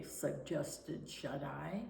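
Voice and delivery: plain reading voice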